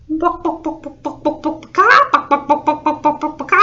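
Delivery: high staccato clucks with loud squawks; agitated hen impression; chicken clucking. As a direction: bok rapidly like a chicken. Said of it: "what sounds do chickens make".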